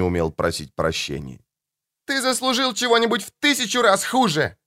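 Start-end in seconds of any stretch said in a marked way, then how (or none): 2.1-4.6 put-on high furious girl's shout; screaming daughter. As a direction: scream it at him. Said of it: "закричала Мишель".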